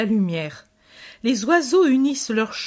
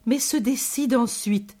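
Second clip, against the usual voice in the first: plain reading voice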